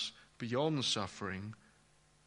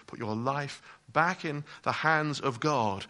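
ordinary delivery

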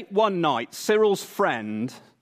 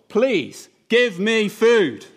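higher, louder, slow demand; bold demand; 6 semitones higher, 5 dB louder, much slower than usual. Acted. Cyril boldly demanding food from the neighbour, loud and slow. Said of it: "he's utterly bold".

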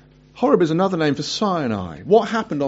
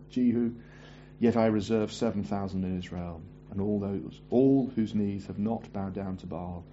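much lower and softer, steady and unhurried; quiet voice of God; 7 semitones lower, 13 dB quieter, faster than usual. Acted. The Lord answering Elijah, low and hushed. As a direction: speak as the Lord, hushed and grave.

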